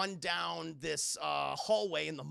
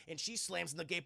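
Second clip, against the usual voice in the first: ordinary talk, nothing marked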